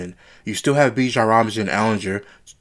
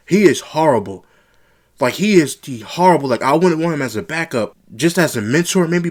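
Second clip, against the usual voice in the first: louder and higher, exasperated rant; fed-up rant; 5 semitones higher, 4 dB louder than usual. Real, not acted. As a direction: vent your frustration at the quarterback. Ranting about a bad quarterback.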